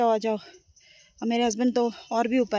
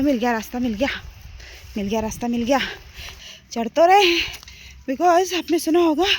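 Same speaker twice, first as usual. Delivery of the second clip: ordinary talk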